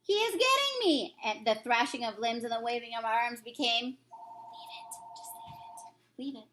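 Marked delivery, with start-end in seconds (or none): 0.0-1.1 high panicked cries; hunted giant begging in his sleep; terrified. Acted. Giant screaming in nightmare. 3.9-6.5 breathless whispered pleading; voiceless pleading; desperate whisper. Acted. Giant pleading in nightmare.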